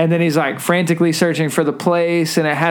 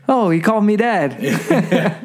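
slow, raised Homer voice; touched Homer; 4 semitones higher, slower than usual. Acted. Homer on being called Dad, slow and touched. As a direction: draw it out as a delighted dad. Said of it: "And then Homer says".

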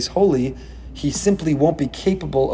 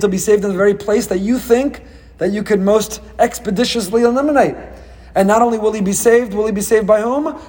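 climbing higher and louder with each question; building taunt; 7 semitones higher, 3 dB louder than usual. Retold or imitated. Preacher mocking Pharaoh's control, building to a crescendo. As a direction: pile it on, each line more triumphant.

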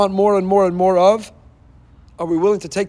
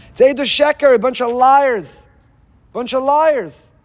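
preaching rebuke; 7 semitones higher, 3 dB louder, slower than usual. Real, not acted. Rabbi rebukes empty Shema.

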